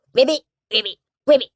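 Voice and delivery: frog croak. Acted. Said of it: "said Margaret Frog".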